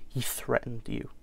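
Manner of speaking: hushed, sardonic American guess; American accent, dropping almost to a whisper on "you"; quiet American jab at a parent's excuse. Acted. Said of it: "[name] will be in an, an American accent".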